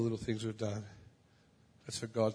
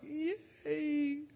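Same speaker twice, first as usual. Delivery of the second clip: inner yay. Retold or imitated. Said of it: "inside I'm like".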